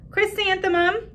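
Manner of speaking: a hint of giggling children; mocking kids. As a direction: classmates jeering her name, high.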